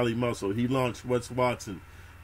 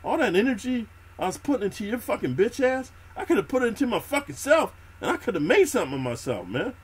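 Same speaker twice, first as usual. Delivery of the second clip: higher, exasperated mock rant at a freeloader; fed-up inner rant; 9 semitones higher than usual. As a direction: vent it high and disgusted, half acted. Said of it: "you fucking realize, like".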